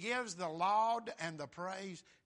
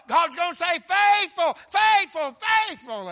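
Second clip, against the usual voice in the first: much louder and higher shouted preaching; 5 semitones higher, 14 dB louder, slower than usual. Acted. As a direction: shout the praise of the faithful.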